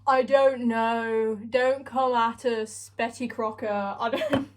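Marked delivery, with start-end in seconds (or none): none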